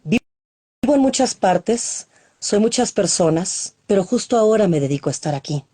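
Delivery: first line drawn out slowly; stretched opening of the poem. Acted. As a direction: open the poem slowly, word by word. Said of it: "este se llama Biografía Universal".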